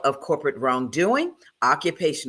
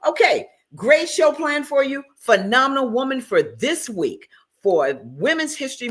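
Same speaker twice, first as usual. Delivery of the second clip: excited; 7 semitones higher than usual. Real, not acted. Host repeats opener, pumped up.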